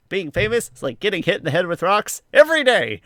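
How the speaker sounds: high, loud mock outburst building to a shout; exclaimed quote. Co-host acting out his favorite line from the episode, exclaimed.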